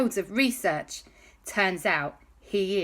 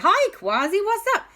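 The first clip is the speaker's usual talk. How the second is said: put-on high bright child's greeting; cheery kid voice; 14 semitones higher, 5 dB louder than usual. Alexander Christopher greets Kwazii on the call, high cheery kid voice. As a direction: chirp it like a young kid.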